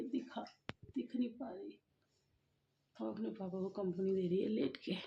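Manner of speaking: soft low voice so as not to wake them; hushed voice near sleeping family. Real, not acted. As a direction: keep it down, someone is sleeping. Baby and father asleep nearby.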